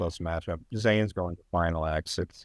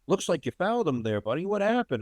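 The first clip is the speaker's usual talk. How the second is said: raised, higher voice of a chummy official; mock referee voice; 10 semitones higher than usual. Retelling the ref's question on camera, higher mock voice. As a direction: play the ref quizzing him, pitched up. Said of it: "he said".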